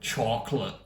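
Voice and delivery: low, soft American "chocolate"; drawn American vowel in chocolate; American accent. Shown as a demonstration. Vlogger showing how Americans say it, low American accent. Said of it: "Americans also say it".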